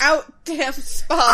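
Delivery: dramatic Lady Macbeth. Acted.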